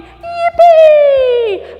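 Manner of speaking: long, high, loud whoop; squealed celebration; gleeful cheer. Acted. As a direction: whoop with storybook joy. Celebrates happy ending.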